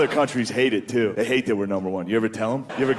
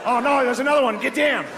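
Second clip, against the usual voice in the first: much higher, hammed-up Australian accent; 10 semitones higher than usual. Australian office guy on nine eleven, mock Australian accent. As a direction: play a panicked Australian, Australian accent. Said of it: "an Australian guy in the office".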